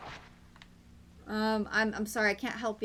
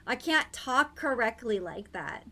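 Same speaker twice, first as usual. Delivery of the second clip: ordinary talk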